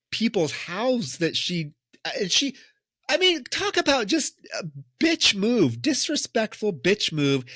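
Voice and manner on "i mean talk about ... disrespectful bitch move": outraged. Real, not acted. Outraged judgment of character's act.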